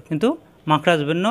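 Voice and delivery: ordinary talk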